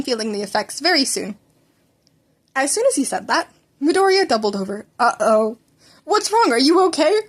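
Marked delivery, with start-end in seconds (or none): 6.0-7.2 higher and anxious, leaning in; worried. Acted. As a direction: ask with real concern for the boy. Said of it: "All Might asked worried".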